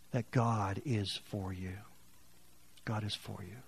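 very soft, slow, tender assurance; intimate reassurance. Real, not acted. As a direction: reassure them gently, almost hushed.

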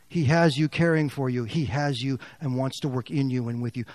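ordinary talk, nothing marked

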